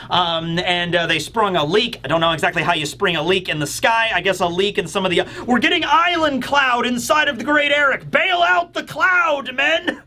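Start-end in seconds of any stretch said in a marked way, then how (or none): 5.5-10.0 high, strained sailor's alarm; mock alarmed crew call. Giant pirate ordering crew to bail out, hammed-up alarm. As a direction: draw out the orders like a panicked captain. Voicing crew of a leaking ship.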